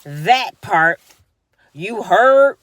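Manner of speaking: slow mocking street voice. Mocking the mayor's slang at the podium, slow. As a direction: drag it out, mocking her slang. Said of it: "She be like".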